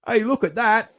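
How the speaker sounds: high-pitched put-on British accent; hammed-up Brit, pitched well up. Shown as a demonstration. Aussie shows friends his best British accent, high and put-on. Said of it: "do a British accent".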